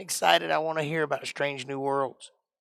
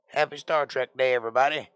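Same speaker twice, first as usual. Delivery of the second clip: normal talking voice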